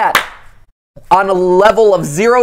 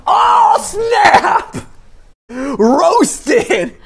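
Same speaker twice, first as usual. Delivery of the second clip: excited shout; 11 semitones higher, much slower than usual. Real, not acted. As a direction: crow over the burn. Reacts to a burn.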